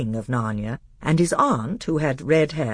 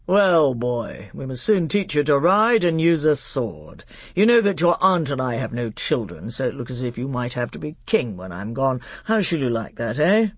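pompous, condescending uncle king; indifferent grown-up royal making conversation; King Miraz. Acted. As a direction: talk down to a small boy. Voices King Miraz to his nephew.

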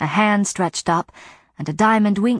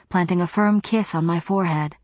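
plain reading voice

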